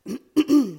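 loud throat clear from a cold. Real, not acted. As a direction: clear a croaky throat hard. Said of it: "gaat mijn stem er een beetje vandoor".

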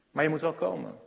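ordinary delivery

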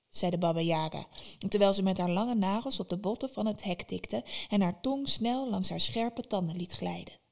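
ordinary delivery, nothing marked

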